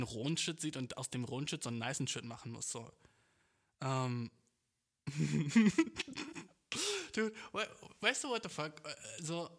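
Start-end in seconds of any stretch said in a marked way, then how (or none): 5.1-6.4 sudden loud burst of laughter. Real, not acted. Vlogger losing it at his own rant, loud laugh. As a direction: crack up out loud.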